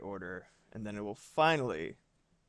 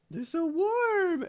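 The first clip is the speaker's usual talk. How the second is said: cooing; 6 dB louder, much slower than usual. Real, not acted. Streamer cooing over the kitten's warm paws. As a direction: stretch it out high and sweet.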